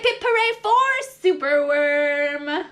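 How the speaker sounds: ordinary delivery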